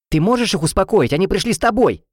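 high, urgent reproach; agitated demand. A narrator's reading. Heroine to rival amid brawl, agitated.

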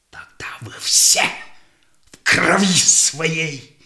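whispered threat of death; dark whispered curse; menacing whisper. Acted. Sahei curses his captors.

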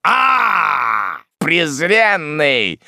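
high, loud, drawn-out curse; bellowed contempt; enraged shout. A narrator's reading. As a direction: cry out in fury, stretching the words. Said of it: "закричал малорослый".

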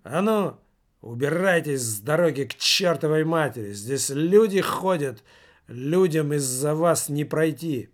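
ordinary delivery, nothing marked

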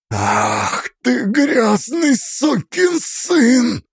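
slow, strained snarl through clenched teeth; hissed through teeth. A narrator's reading. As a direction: spit out each word with slow fury. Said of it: "процедил мастер сквозь зубы".

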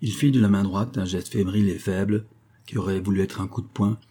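ordinary delivery, nothing marked

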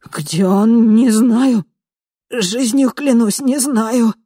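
high pleading swearing; terrified pleading. A narrator's reading. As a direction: beg for belief. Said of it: "пробормотал Авгин".

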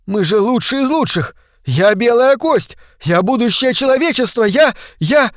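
very high, strained, desperate cry; screaming inner protest. A narrator's reading. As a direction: protest wildly against certain death. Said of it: "вопил голос в голове Оушена". (отчаянный вопль на высоких нотах)

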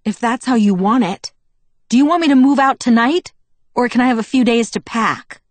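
loud, high, cutting ultimatum; icy defiance. A narrator's reading. Daughter threatens to move out, icily defiant. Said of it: "My glare turned icy".

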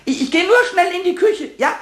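high, stammering, eager promise; hurried excitement. Acted. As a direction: rush it out while dashing off. Hurriedly promises mouse to return. (aufgeregt, hastig und hoch)

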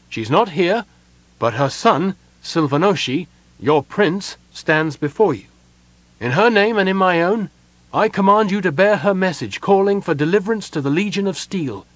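high, ringing princely command; imperious. A narrator's reading. Prince commands elves to carry a message, imperiously. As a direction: declare your rank and issue the order. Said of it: "his voice imperious".

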